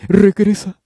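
very high, very loud scream; furious shout. Acted. Headmistress cutting Candy off with a shrieked order.

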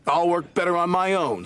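high, sniffy huff. Acted. Douglas sniffing proudly at Thomas. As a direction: say it with wounded pride. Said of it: "sniffed Douglas".

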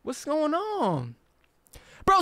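ordinary delivery

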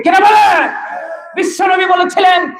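high, sustained rousing cry; full-force preaching. Real, not acted. Preacher tells crowd of the Prophet's promise, high full-force preaching. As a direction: drive every phrase out high to the crowd.

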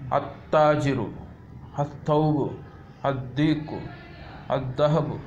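first consonant held double after the a, as in "Ad-Diku"; doubled first letter. Shown as a demonstration. Teacher reading out sun-letter examples, article's l merged into a double consonant. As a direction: list them one by one, doubling the consonant as in "Ar-Rajulu". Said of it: "shadda after lam".

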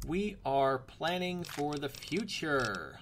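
slow, deliberate declaration; mock-grand. Gamer justifying his ammo purchase, slow mock-grand declaration. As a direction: stretch it out like a grand pronouncement.

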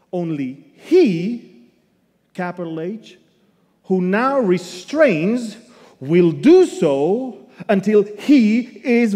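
loud, slow pulpit reading; forceful Bible reading from the pulpit; scripture reading. Acted. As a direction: proclaim the verse to the congregation. Preacher reads slowly.

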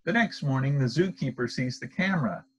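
ordinary delivery, nothing marked

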